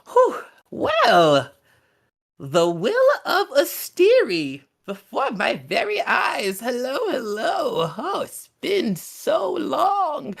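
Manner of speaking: high, quavering crone voice; awed old hag. Acted. Old hag greeting the Will of Asteri, high and quavering. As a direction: marvel like an ancient witch seeing an old friend.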